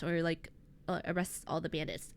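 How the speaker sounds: ordinary delivery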